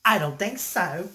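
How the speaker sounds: put-on Australian accent. Refusing the kangaroo's proposal, Australian accent. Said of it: "I said it with my Australian accent".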